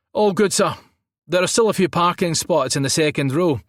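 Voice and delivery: loud, high Scottish official; thick Scottish accent. A narrator's reading. Guard clears guest to park, thick Scottish accent. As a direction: call it out in broad Scots. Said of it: "A thick Scottish accent".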